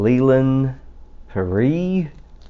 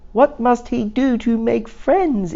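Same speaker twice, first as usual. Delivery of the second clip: high, wondering goat voice; puzzled goat. Acted. Goat wondering how to make friends, high and puzzled.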